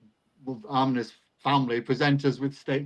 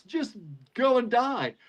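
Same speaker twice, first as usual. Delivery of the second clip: slow, higher-pitched mock dismissal; Scrooge; 8 semitones higher, slower than usual. Teacher retelling his answer to a dieting colleague, a hint of Scrooge. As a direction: lightly play a heartless miser sending someone off. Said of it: "it was Scrooge, wasn't it?".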